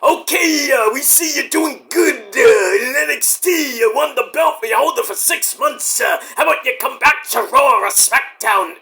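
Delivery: hammed-up mock Hunter; Hunter impression. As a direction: call him back up like a pushy boss. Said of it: "little call from Hunter, like".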